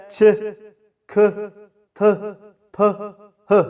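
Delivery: چ کھ تھ پھ ہ each said alone as a bare aspirated sound; teacher demonstrating aspirated consonants one by one; sounding out letters. Shown as a demonstration. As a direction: isolate each sound: چ، کھ، تھ، پھ، ہ with a clear puff of breath. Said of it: "ان کی جو first sounding ہے وہ یہ ہے".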